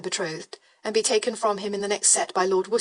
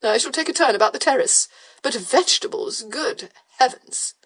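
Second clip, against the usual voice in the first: foppish beau; 4 semitones higher, 4 dB louder than usual. Acted. Beau reporting gossip to Brandon, affected high voice. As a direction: play him as an affected society dandy.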